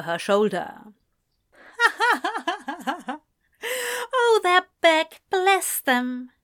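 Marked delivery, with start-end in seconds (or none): 1.8-3.2 high, rippling head-thrown-back laugh; bright peal of acted laughter; hearty laugh. A narrator's reading. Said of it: "threw her head back and laughed". 4.1-6.3 loud, high, fond exclamation; delighted character voice after a laugh. A narrator's reading. Eliza, after laughing, spots the men.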